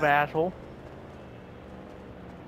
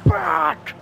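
sudden curse; 9 semitones higher than usual. Real, not acted. Gamer swearing at a mishap in play, sudden curse.

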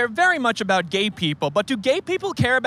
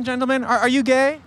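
ordinary delivery

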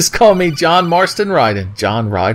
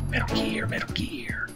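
breathy dramatic whisper; hushed title whisper; 15 dB quieter than usual. Pretend.

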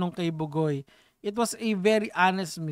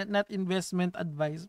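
ordinary delivery

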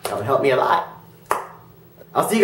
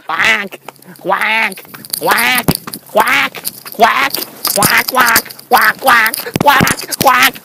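loud, repeated mock quacking; duck imitation; 3 dB louder, much slower than usual. Imitating ducks to get answers.

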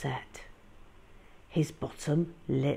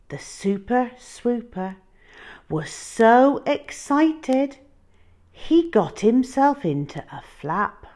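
excited narration; 8 semitones higher, 8 dB louder than usual. A narrator's reading. Narrating the dinosaur's excitement, raised and eager. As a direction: let the excitement grow.

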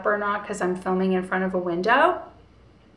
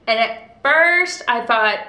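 usual speaking voice

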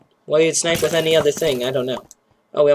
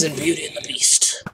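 whispered aside; 9 dB quieter than usual. Real, not acted. Aside after beast cry.

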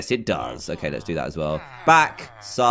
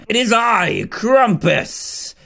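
a hint of a grand villain reveal; lightly voiced Krampus; 7 semitones higher, much slower than usual. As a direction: announce yourself like a mild monster.

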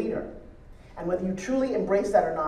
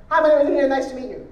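mock rushed greeting, higher and run together; rushed introduction; 4 semitones higher, 5 dB louder than usual. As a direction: gabble the introduction like a thoughtless habit. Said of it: "you say it so fast".

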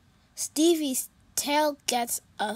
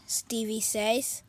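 ordinary delivery

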